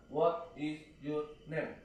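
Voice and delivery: slow "What is your name?" with every word pulled apart; halting, over-careful schoolbook reading; spelled-out reading. Shown as a demonstration. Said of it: "Itu kayak diejah, nggak boleh".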